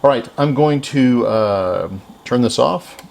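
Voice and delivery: ordinary talk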